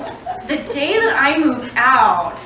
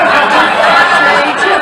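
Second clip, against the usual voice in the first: louder, faster hammed-up panic, ending on a rising do; mock panicked outcry; 7 dB louder than usual. Comic telling the crowd her panicked reaction, hammed-up. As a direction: fake freak-out, pushing it out fast. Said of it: "and I'm like".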